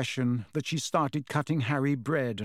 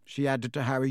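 usual speaking voice

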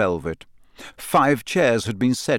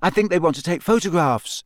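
put-on high-pitched, anxious boy explaining; nervous; 7 semitones higher than usual. A narrator's reading. Pupil justifying himself to teacher, nervous. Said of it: "he said nervously".

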